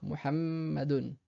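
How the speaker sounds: Muhammadun lentement, m tenu lèvres fermées; Muhammadun, bloquer le m sans ouvrir la bouche; doubled m, held. Shown as a demonstration. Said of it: "et sans ouvrir la bouche". (Muhammadun avec le m long et fermé)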